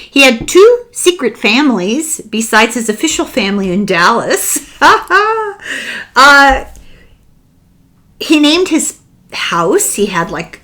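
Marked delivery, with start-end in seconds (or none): 4.8-6.1 a burst of high, incredulous laughter; high amused laugh. Real, not acted. Telling listeners about secret families, a high real laugh.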